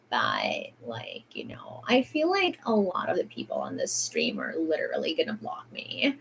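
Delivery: hushed whisper aside; whispered confession to viewers. Real, not acted. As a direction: drop to a whisper as if telling a secret.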